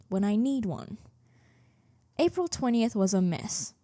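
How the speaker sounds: ordinary talk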